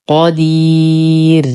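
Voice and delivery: قدير drawn out slowly, long ي into a light ر; thinned final raa. Shown as a demonstration. Teacher shows the raa when stopping, long and thinned. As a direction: stretch the ي of قدير and stop on a thin ر. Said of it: "فهي مرققة لأنها سبقت بياء مادية".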